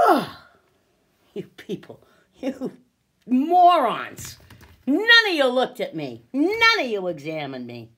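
loud, indignant accusation; angry outburst. Real, not acted. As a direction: lash out at people who ignored you. Berating those who ignored her.

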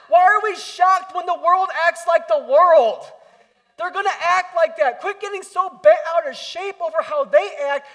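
high, loud, fervent pulpit delivery; full-force sermon climax; preaching at full force. Acted. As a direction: drive the point home to the congregation.

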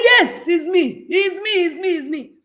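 high, loud, excited yelling; villager's shout. Retold or imitated. Speaker reenacting her reaction at the meeting, excited shouting. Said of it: "I just shouted like one villager".

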